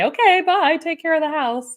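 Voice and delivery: a bright, high farewell to the bird; cheery goodbye. Retold or imitated. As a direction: wave someone off brightly. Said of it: "I say".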